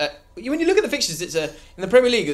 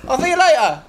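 high, proud footballer voice; Lucas Moura impression; 8 semitones higher than usual. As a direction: announce himself with swagger. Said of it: "It's me. Lucas Moura".

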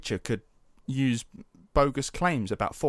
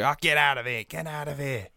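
dismissive crowd; 3 semitones higher than usual. Acted. Congregation booing the teenager away.

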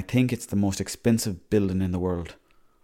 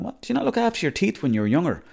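higher, lightly mocking dentist voice; a hint of a scolding dentist, pitched up; imitating the dentist; 9 semitones higher than usual. Dentist quizzing him mid-checkup, in a mock higher voice.

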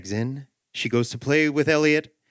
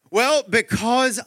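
drawn-out, higher, searching for an answer; stalling dad; 10 semitones higher, 3 dB louder, slower than usual. Acted. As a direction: stretch each word while you think.

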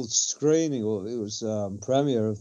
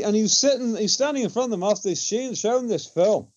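normal talking voice

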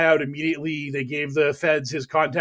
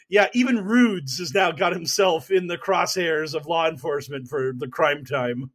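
ordinary delivery

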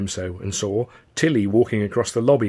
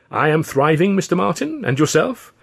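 a touch of a courtly gentleman; 7 semitones higher, 5 dB louder than usual. Acted. Martin's courtly aviator greeting.

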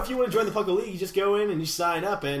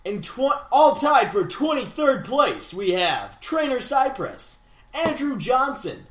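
slow, loud, grand roll call; mock-epic ring announcer; 6 semitones higher, 5 dB louder than usual. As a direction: proclaim each name like a champion's entrance. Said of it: "That was way too epic".

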